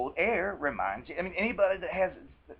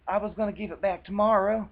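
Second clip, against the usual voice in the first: higher, a touch louder, mock innocent; a mildly hammed-up fibbing boyfriend; fake excuse; 6 semitones higher, 5 dB louder than usual. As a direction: toss off a lame made-up excuse. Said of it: "like a fake excuse".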